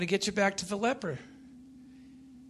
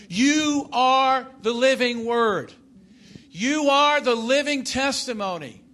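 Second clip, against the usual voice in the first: slow, loud, raised proclamation; full-force preaching; 6 semitones higher, 7 dB louder than usual. Real, not acted. Preacher commissioning listeners as living testimony, full-force preaching. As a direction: declare it over the congregation with force.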